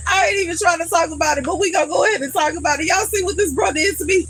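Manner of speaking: high, fast, worked-up complaining; upset rant. Real, not acted. To viewers about the stained shirt, high fast upset rant. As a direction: vent it fast and high, half joking. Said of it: "I'm so mad".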